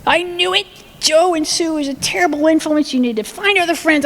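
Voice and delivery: high-pitched, alarmed dad jumping to conclusions; overreacting dad. Dad reacting to child's question about getting drunk, alarmed and high-pitched. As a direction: play a dad pouncing on his kid's confession. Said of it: "Of course, Dad, like".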